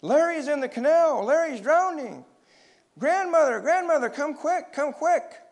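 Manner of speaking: high, loud panicked yell; urgent storybook shout at full pitch; shouting in horror. A narrator's reading. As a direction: scream for help from far off. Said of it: "began to shout at the top of his voice".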